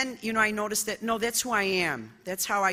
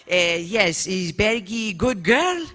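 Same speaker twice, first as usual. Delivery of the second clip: put-on slow, louder accented old father, chuckling; imitating her father; 6 dB louder, slower than usual. Daughter mimics proud father asking about her, slow, loud and amused. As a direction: hams up her proud immigrant dad's broken English. Father voiced in a retold story.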